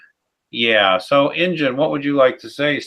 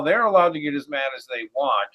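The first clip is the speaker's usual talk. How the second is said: ordinary talk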